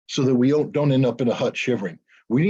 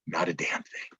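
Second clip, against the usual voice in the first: hushed punchline; 8 semitones lower, 10 dB quieter than usual. Real, not acted. Answering his own question, he whispers the punchline. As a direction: drop to a low unvoiced whisper on "Not a damn thing".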